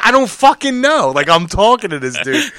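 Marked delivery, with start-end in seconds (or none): 0.0-1.1 higher, louder exasperated shout; shouting back. Retold or imitated. Shouting back to the angry driver behind, exasperated. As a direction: yell it back over the traffic.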